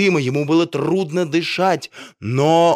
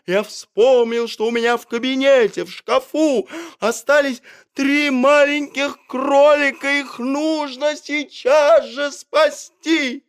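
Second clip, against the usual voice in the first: urgent insistence; 11 semitones higher than usual. A narrator's reading. Doctor refuses, explains rabbits.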